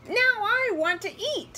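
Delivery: high, loud doll voice; demanding doll. A narrator's reading. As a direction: demand it like a spoiled little toy. Bossy doll demands food.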